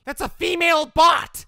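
high-pitched, exasperated shout; comic outraged yelp; indignant protest. Acted. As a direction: object loudly, voice shooting up. Protests bot impersonating him.

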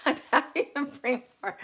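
laughing. Real, not acted. Laughing at own slip.

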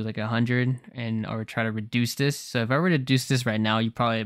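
usual speaking voice

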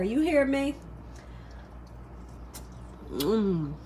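usual speaking voice

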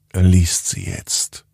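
ordinary delivery

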